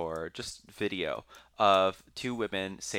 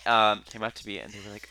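ordinary delivery

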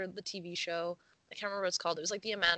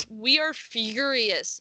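slow, loud mock outrage; mock furious; 3 semitones higher, 7 dB louder, slower than usual. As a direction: ham up the fury. Dramatic reading of quote.

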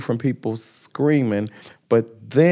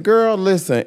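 put-on higher, firm girl listen; a touch of sass; sassy retort; 7 semitones higher than usual. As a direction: set her straight with sass. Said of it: "I said".

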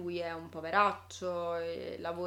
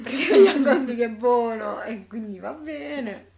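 laughing; 3 semitones higher, 11 dB louder than usual. Real, not acted. Gushing about Ryan Gosling, laughing.

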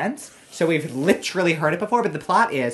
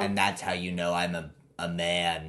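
mock creaky voice, lower and softer; creaky manly voice; 9 semitones lower, 7 dB quieter than usual. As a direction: creak it out to sound like a man. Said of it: "creaky voice".

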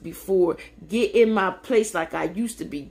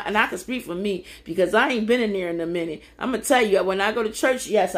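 ordinary talk

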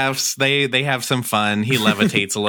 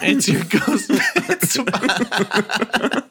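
very slow, laughing, and higher; slow drawn-out repeat; 10 semitones higher, much slower than usual. Pretend. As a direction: stretch the punchline out while laughing. Repeating the joke slowly.

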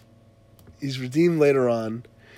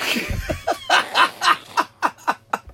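long high laughter. Real, not acted. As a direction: crack up at the end. Laughing at the punchline.

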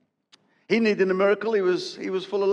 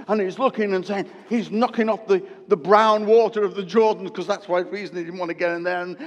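ordinary delivery

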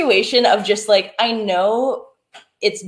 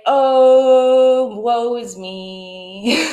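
mock dramatic sigh; 3 semitones higher, much slower than usual. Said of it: "just being like".

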